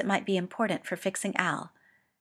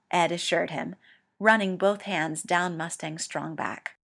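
plain reading voice

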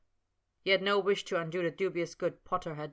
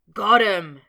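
loud, high triumphant shout; triumphant cry; 4 semitones higher, 16 dB louder than usual. A narrator's reading. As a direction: crow it out in triumph. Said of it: "came the triumphant cry".

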